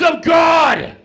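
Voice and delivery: sudden high, full-voiced bellow; booming shout. Describing a movie on a plane, booming shout. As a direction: burst into a zealous shout.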